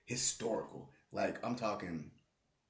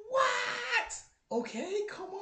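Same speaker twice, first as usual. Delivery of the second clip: high, loud squeal of disbelief; shocked what; 5 dB louder, much slower than usual. Real, not acted. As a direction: jump way up in pitch in disbelief. Disbelief at Nuggets comeback.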